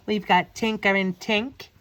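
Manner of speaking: usual speaking voice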